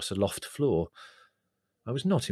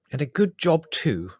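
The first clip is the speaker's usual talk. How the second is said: grim; 7 semitones higher, 7 dB louder than usual. Acted. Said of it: "said Mother grimly".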